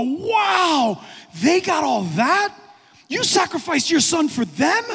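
high, loud, amazed disbelief; awestruck angels. Acted. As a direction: marvel like astonished angels. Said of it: "the angels are in awe of us".

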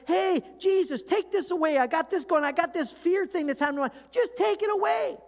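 high-pitched, whiny mock begging; pleading prayer. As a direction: beg frantically for rescue, voice pushed way up. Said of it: "so often we pray".

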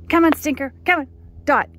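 high loud coaxing call; calling the dog. Real, not acted. Owner coaxing her dog back, loud high call.

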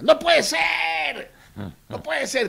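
high, loud, drawn-out cry of disbelief; mock the rich men's outraged shock. Rich friends hearing the result, shocked outcry. Pelucones hear the election result.

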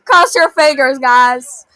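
ordinary talk, nothing marked

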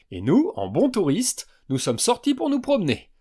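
high, playful teasing; mock-cheerful joke; joking. A narrator's reading. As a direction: tease the group lightly. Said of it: "plaisanta Xia".

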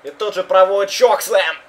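live wrestling play-by-play; wrestling commentator. Acted. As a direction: call the match live. (комментатор рестлинга)

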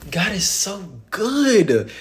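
awed praise. Real, not acted. Overwhelmed preacher praising God.

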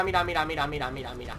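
low, soft repeats sinking away; hushed muttering. Real, not acted. Gamer watching an enemy, muttering low to himself. Spots enemy while playing.

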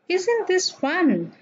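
high, slow, delighted reading; stretched out, thrilled question; exclamatory example. Shown as a demonstration. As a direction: read it like a gleeful exclamation. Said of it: "Exclamatory sentences".

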